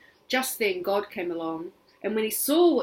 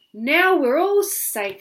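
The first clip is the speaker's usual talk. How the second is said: put-on relieved child; 7 semitones higher, 9 dB louder, slower than usual. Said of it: "said Tin".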